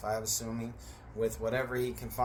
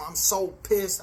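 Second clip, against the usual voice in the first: heated anger; 6 semitones higher, 6 dB louder, slower than usual. Real, not acted. Recounting the fight.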